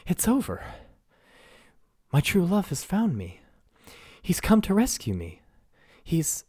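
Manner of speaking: giddy with the curse lifted; joyful princess. Acted. Princess waking from her curse, overjoyed and high-pitched.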